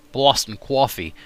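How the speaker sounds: slow, lightly put-on Boston accent; a hint of Boston. Shown as a demonstration. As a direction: drawl Boston coffee. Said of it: "coffee is the big example that everyone uses for Boston".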